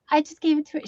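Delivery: ordinary delivery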